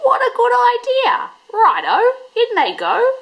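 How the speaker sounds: high excited dog agreeing; gleeful Dingo. A narrator's reading. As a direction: pounce on the idea with delight. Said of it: "Dingo laughed".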